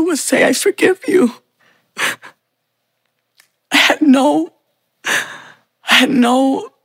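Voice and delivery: fighting through real tears as she recalls him; crying. Real, not acted. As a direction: voice breaking high with tears, slowing down. Tearful reunion with blind father.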